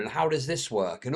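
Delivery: ordinary talk, nothing marked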